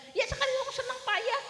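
high wailing sob of joy; happy sobbing. Retold or imitated. Mother explaining her joyful tears, high wail. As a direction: cry it out high, half laughing. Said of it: "kenapa sira nangis".

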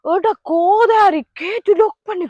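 slow angry yell at the locked gate; angry outburst. Acted. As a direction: spit the curse out slowly in rage. Finds the gate locked, curses.

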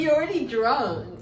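ordinary talk, nothing marked

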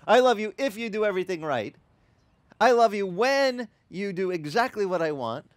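voicing the conditions God never sets; mock demanding God. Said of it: "He doesn't say".

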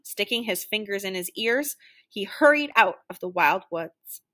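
usual speaking voice

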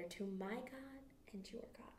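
whispered close of the quote; hushed. A narrator's reading. Jesus gives his message, ending in a whisper. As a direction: fade to a whisper.

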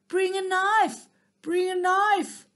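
high, loud shout from far off; distant call. A narrator's reading. Hunter calling loudly across the meadow for a knife. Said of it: "he heard a distant call".

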